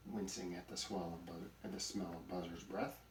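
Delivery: usual speaking voice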